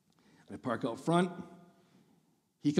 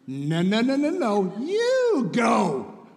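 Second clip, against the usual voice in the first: louder, rising no's into a shouted you go; insistent shout; 6 semitones higher, 5 dB louder, much slower than usual. Retold or imitated. Dad out-insists the other driver, building to a shout. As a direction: insist hard, building to a yell. Acted standoff at four-way stop.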